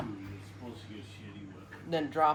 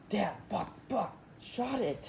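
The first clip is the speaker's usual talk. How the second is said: soft, urgent hunting alert; hushed alert; 8 dB quieter, slower than usual. Retold or imitated. Boy alerts dad while hunting.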